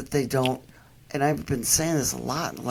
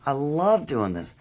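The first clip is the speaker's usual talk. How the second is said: ordinary talk, nothing marked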